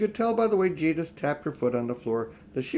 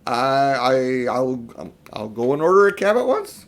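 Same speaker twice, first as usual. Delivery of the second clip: frightened stammering gasp; 7 dB louder than usual. A narrator's reading. Uncle Andrew gasps in fear at the witch, stammering. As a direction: blurt it out terrified. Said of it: "gasped Uncle Andrew".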